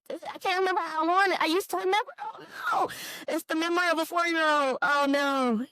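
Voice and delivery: high, fast, flustered scramble to remember; flustered squeal. Real, not acted. Flustered memory lapse.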